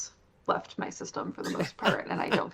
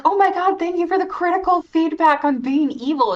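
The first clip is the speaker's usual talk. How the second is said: much higher, louder gushing thanks; overjoyed gratitude; 11 semitones higher, 10 dB louder than usual. Retold or imitated. Speaker imitating the sincere villain thanking Susie, high excited gush. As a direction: gush like a sweet villain genuinely thrilled. Voicing sincere grateful character.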